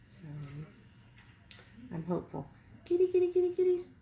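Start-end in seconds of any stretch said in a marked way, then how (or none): 2.9-3.9 high, loud sing-song kitty call; high loud cat call. Real, not acted. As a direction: call the cats high and bright. Calling cats.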